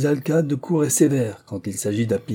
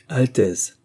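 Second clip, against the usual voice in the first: plain reading voice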